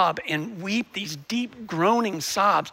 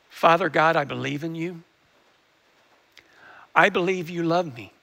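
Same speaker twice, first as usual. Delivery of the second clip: lower, measured prayer lines; leading a prayer; 3 semitones lower than usual. Acted. Pastor leading the congregation in prayer, lower and measured. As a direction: give each line for them to repeat.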